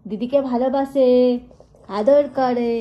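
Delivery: dote loudly on the pet; loud praise. Real, not acted. Owner loudly doting on her pet to viewers.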